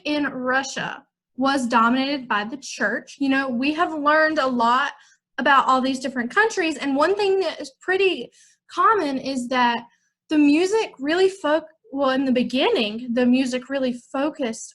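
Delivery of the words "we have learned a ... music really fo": ordinary talk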